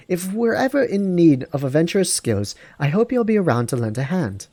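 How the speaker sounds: high female voice with British-style dialect; her British-tinged dialect. Acted. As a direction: put on her lilting British country dialect. Same game character again.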